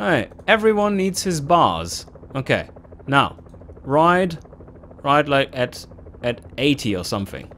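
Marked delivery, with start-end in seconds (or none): none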